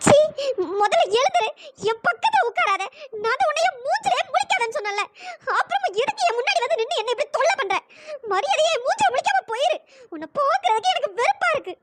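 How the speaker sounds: hurt, bitter rejection with lifts in pitch; tearful anger. Acted. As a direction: push him away in disgust. Crying woman rejects man's apology.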